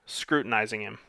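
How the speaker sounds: ordinary delivery, nothing marked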